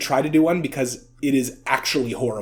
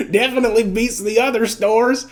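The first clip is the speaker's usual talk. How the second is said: laughing; 6 semitones higher than usual. Retold or imitated. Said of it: "repeating Tony's ad line".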